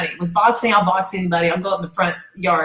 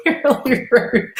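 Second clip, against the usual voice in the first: high, slow, breaking into a laugh; 4 semitones higher, much slower than usual. Real, not acted. Recalling her rude rival, laughing. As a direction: laugh through the words.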